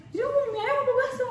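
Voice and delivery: very high-pitched mock demand; imitated exasperated tone. Girlfriend imitates boyfriend demanding noodles or meatballs, exasperated high pitch. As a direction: imitate an impatient question, pitched far up. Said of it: "ngomongnya gini".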